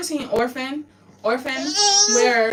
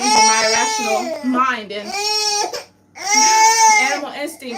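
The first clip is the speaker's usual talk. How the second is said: louder, tearful outburst; crying out; 10 semitones higher, 6 dB louder than usual. Real, not acted. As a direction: cry it out. Crying out in despair.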